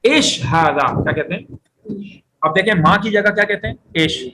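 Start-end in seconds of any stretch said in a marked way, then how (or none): 0.0-1.0 ایش ھذا said slowly, each word drawn out as eish haadha; teacher models Gulf phrase ایش ھذا slowly; slow Gulf Arabic demo. Shown as a demonstration. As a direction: spell out ایش ھذا slowly for students to copy. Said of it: "وہ یوں بھی کہتے ہیں،".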